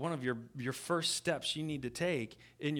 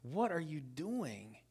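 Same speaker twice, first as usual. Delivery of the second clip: disbelief; 8 dB quieter, slower than usual. Retold or imitated. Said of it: "And I'm just like".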